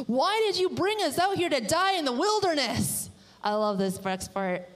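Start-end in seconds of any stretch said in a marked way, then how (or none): 0.1-3.1 high, swooping complaint; complaining. Acted. Israelites complain to Moses in the wilderness, whiny complaint. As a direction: whine it out in protest at being led here. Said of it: "a lot of complaining".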